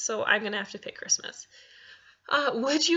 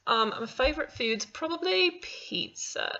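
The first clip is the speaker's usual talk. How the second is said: put-on British accent, raised pitch; 6 semitones higher than usual. Vlogger naming her favourite food in a put-on British accent. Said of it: "your best British accent".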